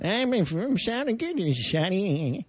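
put-on very slow, creaky old-man voice. Podcast host jokes, slow quavering old man. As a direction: let it crawl out like a tired old man. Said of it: "that was [name] being an old man".

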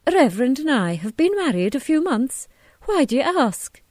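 a touch of prim curiosity; minister's wife. A narrator's reading. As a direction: answer the visitor in character, pitch lifted. Missus Hughes answers a visitor's question.

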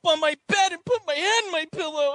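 high, loud, rushed adolescent meltdown; mock teen tantrum. Teenage son storming off from dad, mock tantrum. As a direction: whine it like a furious misunderstood teenager, hammed up.

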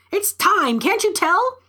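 high-pitched, urgent squawk; cawing parrot. A narrator's reading. Parrot nags its owner that it's time, high caw. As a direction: squawk it high, like an impatient bird. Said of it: "it cawed".

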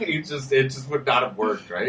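ordinary delivery

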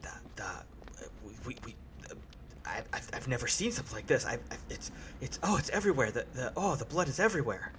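soft, stammering mutter of shock, fast in bursts; quietly under breath. A narrator's reading. Shocked porter pulls away from a passenger, muttering under his breath. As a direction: stumble over words in hushed, rattled disbelief. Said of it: "say very quietly under his breath".

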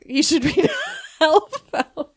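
giggly insistence; laughing. Real, not acted. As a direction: push the book while cracking up. Urging friends to read comic.

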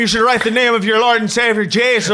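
mock-Irish priest's rebuke; Irish accent. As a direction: preach in a put-on Irish accent, amused. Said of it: "Did he have an Irish accent?".

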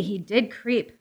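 normal talking voice

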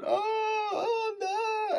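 high, soft mock wail; crying. Drunk passenger sobbing to her cab driver, crying. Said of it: "and she's crying".

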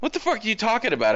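high, fast incredulous retort; mock exasperation. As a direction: imitate her baffled protest. Acts out Riri snapping at AI.

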